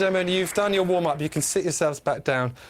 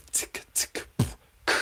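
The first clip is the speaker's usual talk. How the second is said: slower beatbox; 4 dB quieter than usual. Shown as a demonstration. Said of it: "maybe even slower".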